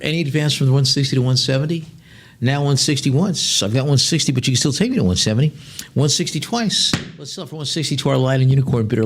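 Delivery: rhythmic, rising auction chant, pushing for the next bid; lively auction patter; auctioneer bid call. Acted. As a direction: keep the bids rolling like an auctioneer. Auctioneer calling bids.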